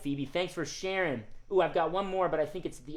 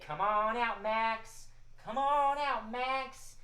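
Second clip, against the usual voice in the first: rising sing-song call, higher than usual; 7 semitones higher, slower than usual. Pretend. Host leads children in calling the cat, sing-song call. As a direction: call the puppet out in drawn-out singsong.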